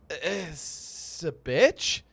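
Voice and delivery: slow, drawn-out Chris catchphrase; imitating Chris. Quoting his friend's podcast catchphrase in a lightly done slow drawl. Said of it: "As he would say on his podcast".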